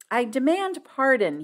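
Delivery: emphatic, projected apology; crying out. A narrator's reading. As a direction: call out to announce yourself. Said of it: "he cried".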